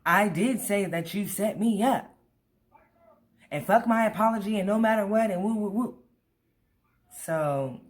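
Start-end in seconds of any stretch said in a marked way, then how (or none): 0.0-6.0 mocking friend. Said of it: "mocking the friend's words".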